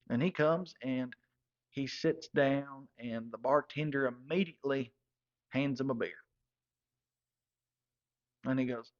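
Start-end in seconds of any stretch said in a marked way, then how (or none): none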